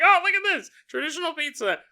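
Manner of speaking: high showy pizza chef voice; mock proud chef; dad showing off dough. Dad showing off his spinning dough, hammed-up pride. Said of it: "He's like".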